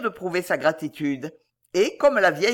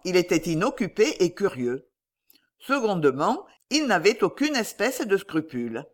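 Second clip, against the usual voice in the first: ordinary delivery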